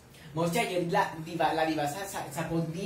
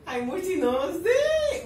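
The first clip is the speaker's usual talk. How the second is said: high, loud whining cry; high-pitched helpless whine; whiny wail; 11 semitones higher, 8 dB louder, much slower than usual. Real, not acted. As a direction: wail it out, drawing out the last word. Exasperated wail while live reacting.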